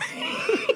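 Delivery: squealing laugh; high squeal laugh. Real, not acted. Dad remembering his daughter cursing, high squealing laugh.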